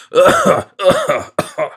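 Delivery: loud convulsing coughs; racking cough. A narrator's reading. Dying miser seized by another violent cough. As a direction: cough hard, the whole body shaking. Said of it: "the cough was so violent".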